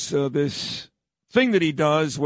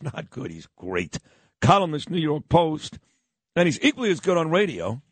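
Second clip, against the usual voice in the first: ordinary talk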